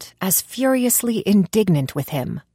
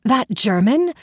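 sharp, higher, louder cry of shock; horrified gasp; 5 semitones higher, 4 dB louder than usual. A narrator's reading. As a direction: blurt it out horrified. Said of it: "I gasped, horrified".

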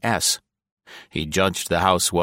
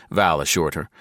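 ordinary delivery

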